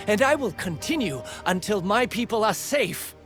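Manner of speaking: heated, high-pitched resolve; defiant vow. Acted. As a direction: declare it fiercely to your enemy.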